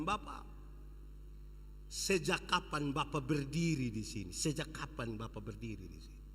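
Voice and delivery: low, soft, moved question to father; soft wondering question. Acted. Child asking his father, tender hushed question. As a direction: ask it tenderly. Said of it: "Dia bilang".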